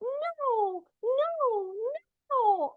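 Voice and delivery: very high, drawn-out storybook refusal; mock angry. A narrator's reading. Reader voices angry Little Bun for children, mock angry. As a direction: play a little bunny's protest, high but not loud. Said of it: "he shakes his head and loudly says".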